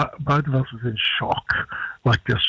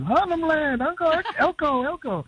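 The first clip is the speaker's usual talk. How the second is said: put-on kids' voices; 13 semitones higher than usual. Kids answering in a retold scene.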